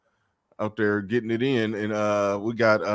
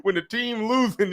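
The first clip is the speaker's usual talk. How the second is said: Charles Barkley; 7 semitones higher, 4 dB louder than usual. Retold or imitated. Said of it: "Charles Barkley was like".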